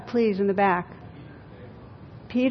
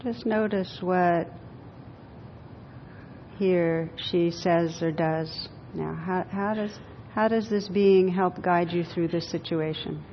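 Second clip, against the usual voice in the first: guided meditation; 3 semitones lower, 7 dB quieter than usual. Acted. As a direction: guide them gently inward, unhurried. Leads a guided visualization.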